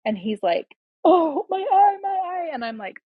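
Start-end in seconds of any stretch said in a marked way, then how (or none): 1.0-2.5 high, louder mock cry of pain; imitated pained wailing. Son holding his injured eye, imitated high-pitched wail. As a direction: mimic a hurt kid's wail.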